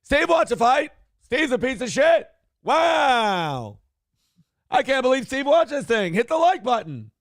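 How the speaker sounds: high-pitched ranting host impression; Kevin Brennan. Mock host railing about Steve to viewers, ranting impression. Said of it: "Mad Dog Russo is like sports Kevin Brennan".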